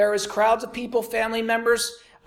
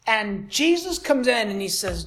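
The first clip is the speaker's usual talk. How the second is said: ordinary delivery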